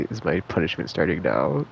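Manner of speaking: usual speaking voice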